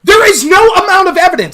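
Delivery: angry shout. Real, not acted. Shouting down the other debater mid-argument, furious. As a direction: shout him down in anger.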